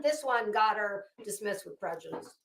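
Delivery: ordinary talk, nothing marked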